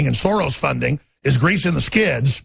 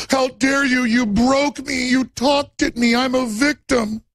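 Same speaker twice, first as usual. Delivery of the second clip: higher, slow, whiny mock hurt; mock her as a crybaby victim; sarcastic pouting victim voice; 7 semitones higher, slower than usual. Mocking Kathy Griffin's reaction.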